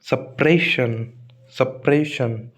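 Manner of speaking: slow suppression, the pray held long; stress on pray. Shown as a demonstration. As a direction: stretch PRAY in suppression. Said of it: "pray पर आएगा शन". (Suppression के pray पर ज़ोर, धीरे)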